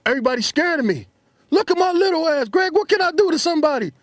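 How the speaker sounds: high, loud, hyped-up voice. Retold or imitated. [name] hugs his old friend, high and hyped. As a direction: brag it giddy and loud. [name] excited greeting quote.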